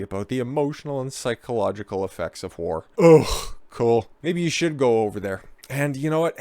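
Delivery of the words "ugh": ordinary talk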